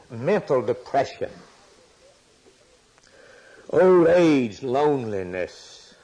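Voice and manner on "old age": loud cry. Real, not acted. Preacher cries out the word.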